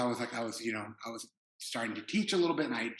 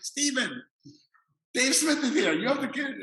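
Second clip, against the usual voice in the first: much higher, louder mock French-Korean accent; 13 semitones higher, 9 dB louder than usual. Martine waking Stephen for the visitor, high French-Korean accent. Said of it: "almost Korean accent".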